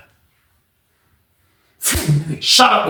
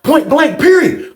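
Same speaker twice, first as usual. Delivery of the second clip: mock shout; 10 semitones higher, slower than usual. [name] shutting down Mike, a sudden high shout. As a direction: voice jumping high on point blank, period.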